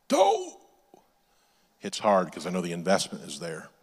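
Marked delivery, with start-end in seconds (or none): 0.1-0.6 sudden high falsetto yelp; comically stung, loud and squeaky high; mock pained reaction. Comic reaction to wife's hard words.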